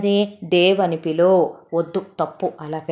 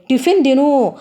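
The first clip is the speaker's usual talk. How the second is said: high, loud command; wife commanding her husband to eat breakfast; bossy order; 7 semitones higher, 7 dB louder than usual. Acted.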